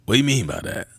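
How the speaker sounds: quick, low mock-baffled celebrity impression; low, offhand comic question; Drewski. Hiker reacting to the guide's warning, Drewski impression. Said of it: "hit it in my best Drewski voice".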